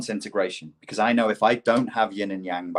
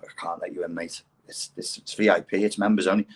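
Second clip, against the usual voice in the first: unmoved doorman; 2 semitones lower than usual. Acted. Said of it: "When I do door work".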